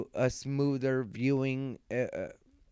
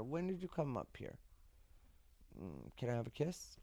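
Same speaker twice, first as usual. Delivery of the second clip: soft talk to a pet; 15 dB quieter than usual. Real, not acted. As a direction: coo gently to the dog. Talking softly to his dog.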